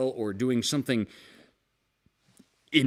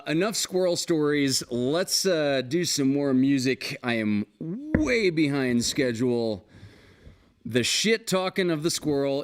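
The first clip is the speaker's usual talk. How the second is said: ordinary delivery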